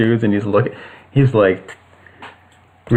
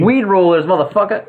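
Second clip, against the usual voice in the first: thug voice; 6 semitones higher than usual. Acted. Thug retorts in character.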